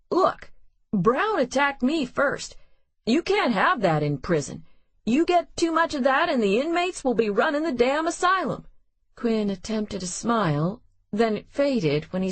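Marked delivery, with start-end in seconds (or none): 0.1-8.7 higher and faster, pushing back; heated justification. Acted. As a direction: argue it hard, voice up. Quinn justifying himself, heated.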